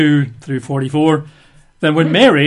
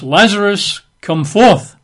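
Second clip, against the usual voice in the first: called out louder, name pitched higher; a raised voice summoning someone out; 5 semitones higher, 5 dB louder, slower than usual. A narrator's reading. Jesus calls the dead Lazarus out of the tomb, loud call. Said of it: "he cried with a loud voice".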